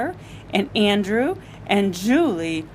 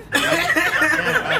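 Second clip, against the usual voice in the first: high giggle after the scream; helpless high laugh; 8 semitones higher, 3 dB louder than usual. Real, not acted. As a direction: burst out laughing.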